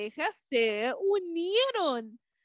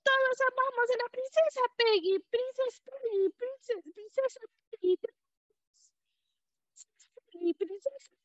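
very high, fading bee song; 10 semitones higher than usual. A narrator's reading. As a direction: sing it tiny and fading. Bees sing farewell.

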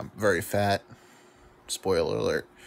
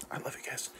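whispered aside; 5 semitones higher, 10 dB quieter than usual. Real, not acted. Aside after awkward Hitler joke.

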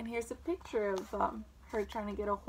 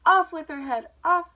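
loud, higher-pitched furious queen's order; screamed command; 6 semitones higher, 10 dB louder than usual. A narrator's reading. Queen ordering Alice's head off, screamed. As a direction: shout the order out sharply. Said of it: "turned red with fear and screamed".

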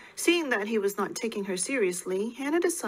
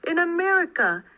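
high, raised-voice outburst; shouted; 7 semitones higher, 6 dB louder than usual. A narrator's reading. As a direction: call it out louder and higher, exasperated. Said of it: "she shouted".

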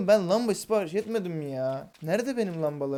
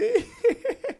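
squealing laugh; 6 dB louder than usual. Real, not acted. Laugh after a stunt.

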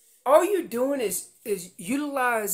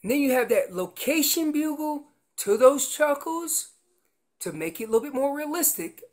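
normal talking voice

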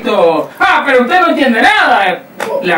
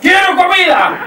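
demanding husband; 7 semitones higher than usual. Acted. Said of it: "me siento en la mesa y digo".

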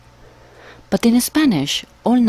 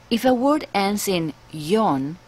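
usual speaking voice

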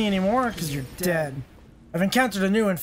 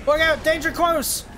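alarm shout; 6 semitones higher than usual. Acted. Warning call in combat.

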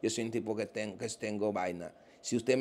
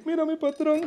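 much higher, whining complaint of a jilted man; mock whiny lament; 11 semitones higher, 3 dB louder than usual. Imitating a rich man whining that his girlfriend left him, high mock whine. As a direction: whine about the woman who dumped you, hammed-up.

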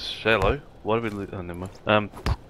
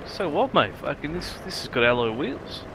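higher mocking bloke voice; pitched-up retold bloke; Mini Minor bloke; 5 semitones higher, slower than usual. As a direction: play the cocky little-car guy, pitched up. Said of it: "Minor bloke's like".

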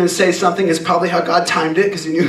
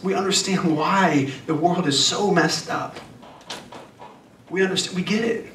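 slower, softer sermon voice, letting each line sink in; soft earnest preaching; 2 semitones lower, 4 dB quieter than usual. Acted. Preacher telling the congregation life is hard, softly earnest. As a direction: lean in and preach it gently.

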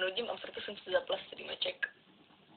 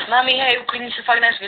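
loud yell across the house, higher; yelling at mom; 4 semitones higher, 14 dB louder than usual. Real, not acted. Teen shouting at her mom to calm down, loud yell.

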